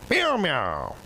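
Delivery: high playful meowing; cat meow. Pretend. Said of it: "National Meow Like a Pirate Day".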